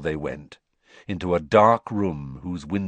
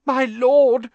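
very high, loud, gasping servant; 8 dB louder than usual. A narrator's reading. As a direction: gasp it out to a feared master. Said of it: "gasped the man on the floor".

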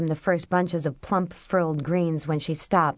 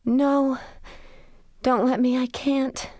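high, desperate plea; begging not to faint; panicked pleading; 8 semitones higher, slower than usual. A narrator's reading.

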